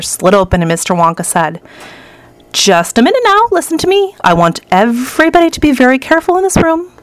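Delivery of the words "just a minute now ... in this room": high-pitched call for attention; urgent announcement. A narrator's reading. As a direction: rein in the group with a high, insistent voice. Wonka urgently warning the group.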